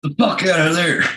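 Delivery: high-pitched imitation of an irate order; mock radio yell. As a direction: act out a driver hollering over the radio. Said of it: "all you heard on the radio".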